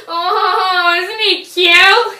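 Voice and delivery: loud, slow, high gushing mom; doting mother. Acted. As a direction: gush over the baby, drawn out. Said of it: "his mother's, like, standing there".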